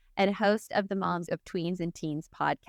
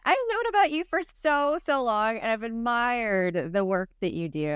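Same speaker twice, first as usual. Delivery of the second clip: normal talking voice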